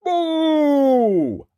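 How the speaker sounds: long, high, booming holler; loud giant's call. A narrator's reading. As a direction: bellow it out and hold the vowel. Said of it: "There came a loud".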